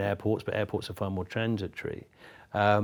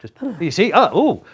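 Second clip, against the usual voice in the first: a much higher, louder hammed-up dying gasp; elaborate mock death cry; 11 semitones higher, 11 dB louder than usual. Showing the host how you die in Wink Murder, hammed-up gasp. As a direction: overact a murder victim's death. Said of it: "Can you die elaborately".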